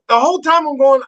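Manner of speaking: ordinary talk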